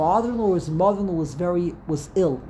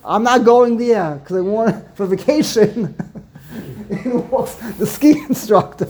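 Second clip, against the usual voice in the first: high, loud, laughing retelling of a punchline; 4 semitones higher, 4 dB louder than usual. Real, not acted. Teacher retelling a Purim spiel's punchline, laughing. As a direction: crack up while hammering home the joke.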